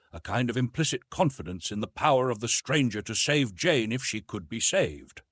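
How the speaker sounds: usual speaking voice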